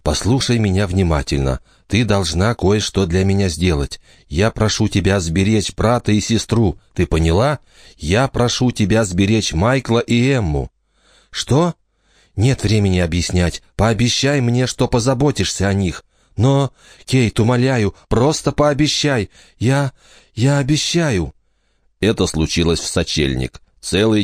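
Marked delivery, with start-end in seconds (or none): 0.0-10.7 urgent whisper. A narrator's reading. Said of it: "тихо и настойчиво зашептала она". 11.3-11.8 startled. A narrator's reading. Startled child wakes. 12.4-16.0 urgent whisper. A narrator's reading. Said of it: "тихо и настойчиво зашептала она". 16.4-21.3 put-on frightened child. A narrator's reading. Frightened child promises mother.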